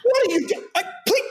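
very high, flustered stammer; hammed-up flustered star; imitating a rattled co-star, very high. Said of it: "completely, like, flustered".

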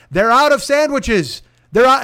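drawn-out shout to strangers in the drive-thru; calling out the news. Retold or imitated. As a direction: announce it to the whole line like a town crier. Shouting to cars waiting in line.

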